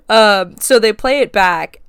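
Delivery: normal talking voice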